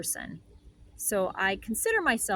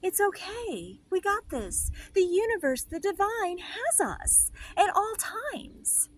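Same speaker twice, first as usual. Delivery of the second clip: much higher, slow, airy and otherworldly; soothing spirit voice; 11 semitones higher, slower than usual. Retold or imitated. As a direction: coo it like a serene guardian angel. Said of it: "my spirit, again, goes".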